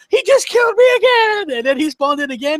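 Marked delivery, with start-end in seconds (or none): 0.1-1.6 very high, loud exasperated yell; mock gamer rage scream; imitating a raging friend. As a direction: scream it like a gamer killed yet again. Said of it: "and he goes".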